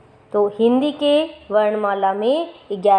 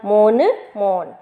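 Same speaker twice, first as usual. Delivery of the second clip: slow drilled word; slower than usual. Shown as a demonstration. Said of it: "फिर औ की मात्रा".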